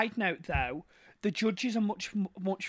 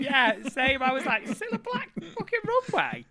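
laughing; 7 semitones higher, 5 dB louder than usual. Retold or imitated. Laughing excited recalled reaction.

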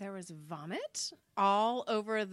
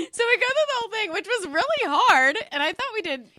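fast, higher and louder; excited; 11 semitones higher, 10 dB louder than usual. Real, not acted. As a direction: rush it out excitedly. Excited recap.

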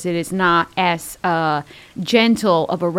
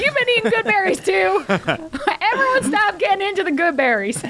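higher, giggling scold; laughing; 10 semitones higher than usual. Real, not acted. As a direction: laugh through the words. Laughing scolding about berries.

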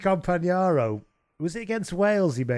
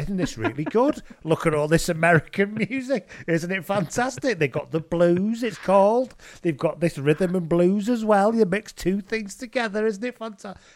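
gushing, higher-pitched mock wonder; mock naive enthusiasm; 4 semitones higher than usual. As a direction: marvel like a wide-eyed novice, sending it up. Mocking naive band discovering American music.

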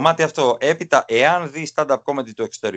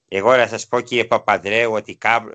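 lower-pitched impression of an ageing politician; Epitimos; 5 semitones lower than usual. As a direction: speak low, as a man no longer thirty-five. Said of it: "έτσι ήταν και με τον Επίτιμο". (μίμηση ηλικιωμένου με χαμηλότερη φωνή)

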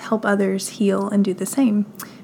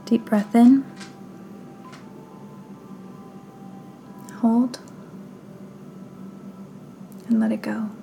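guided meditation; 5 dB quieter than usual. Acted. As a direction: guide them gently through breath and body, unhurried.

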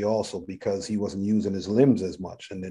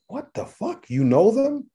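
stunned disbelief; 12 semitones higher, slower than usual. Retold or imitated. Patient seeing his therapist's rap past, stunned high disbelief. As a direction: voice jumps way up in shock.

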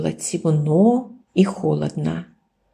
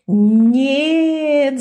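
loud, high cry of protest; the wolf yells as the sheep kisses him; yelling; 6 semitones higher, 5 dB louder than usual. A narrator's reading. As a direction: wail it out in dismay. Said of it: "завопил волк".

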